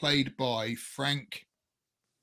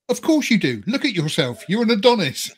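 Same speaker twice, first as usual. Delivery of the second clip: higher, louder gushing mock flattery; mock swooning; 7 semitones higher, 6 dB louder than usual. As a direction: fawn over him like a starstruck fan. Mock flattering Henry Cavill.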